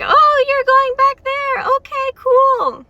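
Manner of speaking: high, slow, gentle sweet voice; gentle character, unbothered. Retold or imitated. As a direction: voice him as kind and easygoing. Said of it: "He's just like".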